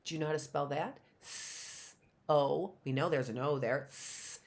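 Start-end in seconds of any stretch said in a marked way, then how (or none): 1.2-2.7 "S-O" as a long sss, then oh; S sound. Shown as a demonstration. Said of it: "What's that sound?". 3.9-4.4 "S" as a long unvoiced sss; S sound. Shown as a demonstration. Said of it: "What's that sound?".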